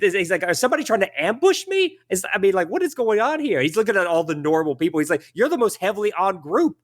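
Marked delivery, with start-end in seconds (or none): 0.4-3.6 high, alarmed halfling indignation; flustered little man; fussing, annoyed. Acted. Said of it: "fussing at a lady". 5.3-6.8 high-pitched incredulous halfling; exasperated little man; fussing, annoyed. Acted. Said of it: "fussing at a lady".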